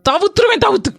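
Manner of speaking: angry daughter-in-law threatens her rival; sharp threat. Acted. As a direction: pitch jumps up on the threat. Threatens anyone insulting her mother-in-law.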